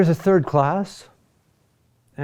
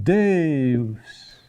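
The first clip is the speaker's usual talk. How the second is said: Deus with a long, drawn-out DE; stress on next-to-last syllable. Shown as a demonstration. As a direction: lean on the first syllable of Deus and hold it. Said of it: "is on the next to last syllable".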